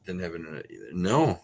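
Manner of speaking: usual speaking voice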